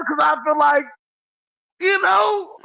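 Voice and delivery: giddy, half-laughing outburst; excited high shout. Real, not acted. Husband defending himself, giddy high shout.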